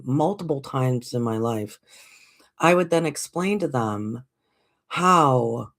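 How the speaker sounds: usual speaking voice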